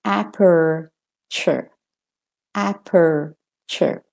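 slow "aperture" with both r's sounded; American. Shown as a demonstration. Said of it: "And for the American".

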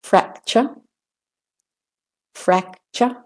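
British-Australian schwa closing "Fracture". Shown as a demonstration. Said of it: "British and Australian first".

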